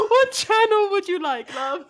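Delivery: mock British waitress, breaking into laughter; laughing through a put-on British accent; British customer service voice. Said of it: "this is my customer service British voice".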